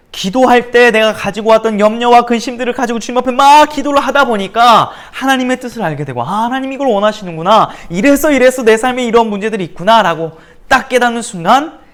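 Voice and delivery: loud, high, driving sermon climax; full-force preaching. Acted. Preacher to his congregation, rising in loud fervent preaching.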